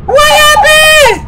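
dragged-out high outcry; disbelieving shout. Real, not acted. Outcry after dying.

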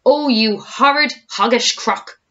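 high, loud, slow insult; indignant cry. A narrator's reading. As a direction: lean hard into each word in outrage. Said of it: "cried Mugglewump".

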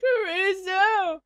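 drawn-out anguished call of her name; anguished cry. Acted. Okabe calling to dying Kurisu, anguished.

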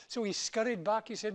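normal talking voice

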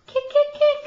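high, loud sing-song cheer; excited cheering. Real, not acted. Cheering baby to kick.

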